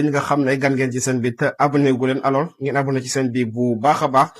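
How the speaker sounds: ordinary delivery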